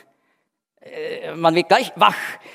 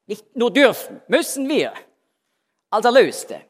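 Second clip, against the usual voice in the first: much higher, louder push on müssen wir; emphatic insistence; 7 semitones higher, 4 dB louder than usual. Real, not acted. Preacher urging the redeemed listeners, emphatic and raised. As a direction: drive home that we must, not only may.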